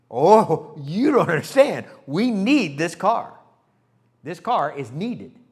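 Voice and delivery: loud, slow insistence; loud retold protest. Husband protesting to his wife, loud and slow. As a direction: plead your case loudly and slowly.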